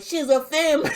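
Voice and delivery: mock crowd heckle; retold yell. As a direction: call it out from the crowd. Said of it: "somebody yelled out".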